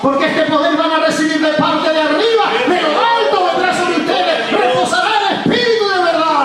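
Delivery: soaring revival preacher at full power; full-force preaching. Acted. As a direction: lift the congregation with a fiery preaching chant.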